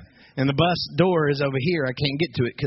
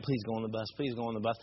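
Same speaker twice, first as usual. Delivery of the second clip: hushed plea; 3 semitones lower, 7 dB quieter, faster than usual. Retold or imitated. Urging kids away from danger.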